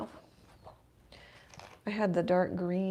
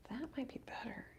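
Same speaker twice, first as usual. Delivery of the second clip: hushed breathy whisper; 5 semitones higher, 15 dB quieter than usual. Real, not acted. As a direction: whisper it under your breath. Repeats her judgement, whispered.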